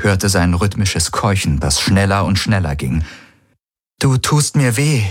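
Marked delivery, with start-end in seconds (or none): 4.0-5.0 higher, mildly distressed objection; pained protest. A narrator's reading. Woman wanting to tell her lover, pained protest. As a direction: protest as if it hurts.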